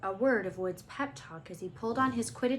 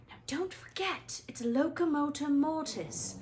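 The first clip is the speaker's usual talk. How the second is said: muttered; 7 semitones higher than usual. A narrator's reading. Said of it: "Hermione muttered".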